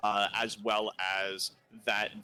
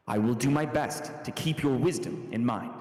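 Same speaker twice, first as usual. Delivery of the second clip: reassured; 2 semitones higher, 4 dB louder than usual. Acted. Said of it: "reassuredly and says".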